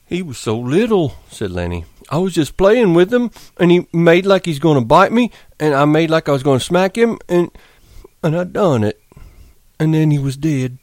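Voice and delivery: a big simple man mourning his pup; Lenny, grieving. Acted. Lenny talks sadly to the dead pup.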